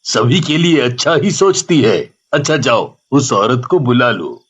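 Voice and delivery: put-on a calm dad asking his girl; father. Father asking his daughter why she stopped the car, low.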